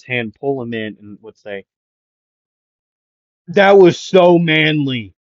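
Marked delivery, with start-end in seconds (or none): 3.5-5.1 loud, drawn-out, higher exclamation; hammed-up heartfelt praise, slow and booming; gushing admiration. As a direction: belt out the admiration, stretching each word. Voicing Kirishima's admiring exclamation.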